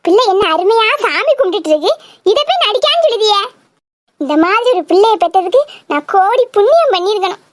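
ordinary talk, nothing marked

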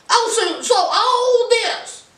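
high-pitched mock indignation; indignant reaction. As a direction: act out a jealous woman's disbelief. Acting Eve's indignant rant.

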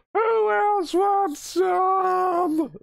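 very high, drawn-out falsetto battle cry; mock yelling. Gamer quoting an episode's fighter, high mock yell. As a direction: imitate a warrior's challenge, stretching every word slowly. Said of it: "Like, yelling".

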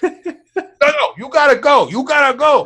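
high, put-on Russian doctor voice; lightly voiced Russian doctor; mock Russian accent. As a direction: shoo him out in a Russian accent. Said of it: "he had that Russian accent".

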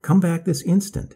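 high, loud, sharp call after her son; mother calling her son back from the woods, high and loud; shrill cry. A narrator's reading. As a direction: shriek the order at the ones leaving. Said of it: "his mother shrilled".

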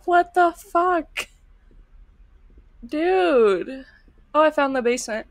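normal talking voice

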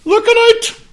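high, loud laughing delivery; laughing squeal. Retold or imitated. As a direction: squeal the punchline through laughter.

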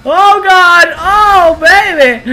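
high, loud whooping at a hit; excited shouting. Real, not acted. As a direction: holler with the thrill of the blast. Blasting enemies after the charge.

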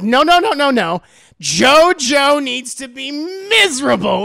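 loud, emphatic villain refusal; mock-villain outburst, stretching the last words; hammed-up objection. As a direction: belt it out in exaggerated protest. Acting Dio's gleeful objection.

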